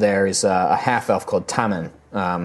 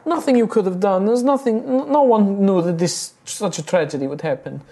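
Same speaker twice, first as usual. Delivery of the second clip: upset halfling woman; 10 semitones higher than usual. Acted. Rosalina consoling the party.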